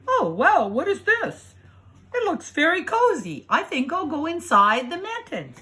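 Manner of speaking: high, wondering wolf. Acted. Wolf finding the cozy mitten, high and wondering.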